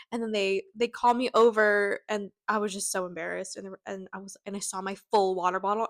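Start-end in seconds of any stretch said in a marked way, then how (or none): none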